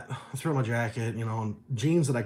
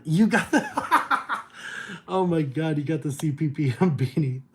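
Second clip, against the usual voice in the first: laughing; 4 semitones higher, 4 dB louder than usual. Real, not acted. Excited reading a fan's message.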